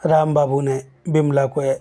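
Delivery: plain reading voice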